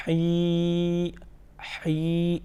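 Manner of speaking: long ہی squeezed from mid-throat, as Arabic ح. Shown as a demonstration. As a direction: hold ہی from the middle of the throat. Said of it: "یعنی وسط حلق سے". (وسط حلق سے لمبی ہی)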